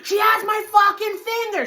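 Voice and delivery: high, loud re-enacted cry to a cousin; yelling for help. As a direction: call out urgently mid-fight.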